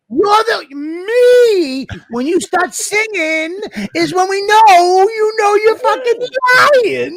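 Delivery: high, laughing mock outrage; squealing with laughter; crying laugh. Real, not acted. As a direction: accuse him while cracking up. Mock blaming friend.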